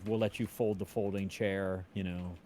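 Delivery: ordinary delivery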